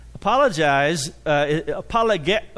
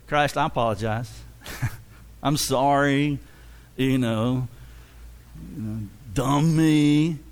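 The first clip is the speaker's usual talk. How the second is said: mock sheepish apology; 2 semitones lower than usual. Shown as a demonstration. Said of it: "we don't go up to Christ and say".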